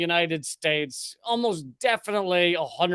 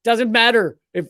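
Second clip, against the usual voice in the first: normal talking voice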